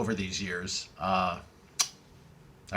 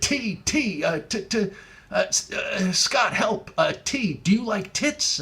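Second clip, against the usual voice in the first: higher, halting, panicky stutter; stammering caller; 6 semitones higher, slower than usual. Retold or imitated. Caller stuck on the letter T, stammering for help. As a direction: grope for a word and fail.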